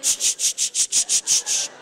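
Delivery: slow, hissing unvoiced sprinkler sound effect; mock lawn sprinkler hiss; sprinkler noise. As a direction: spit out a sprinkler's ch-ch-ch. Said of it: "you're like sprinkler systems".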